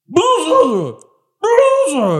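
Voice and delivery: very high, loud buzzing wail; anguished bee buzz. Acted. Game master as bee stinging its friend, loud high buzz.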